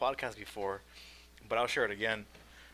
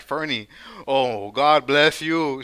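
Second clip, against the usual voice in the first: slow, loud mock blessing; imitating a sanctimonious church girl, drawn out; pious church girl; 3 semitones higher, 12 dB louder, much slower than usual. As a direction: stretch it out with mock holiness. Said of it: "that bitch was right there like".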